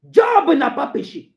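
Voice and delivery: loud, high, slow proclamation; emphatic shout. Acted. Preacher vindicating Job to the congregation, shouting. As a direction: burst out with it, drawing it out.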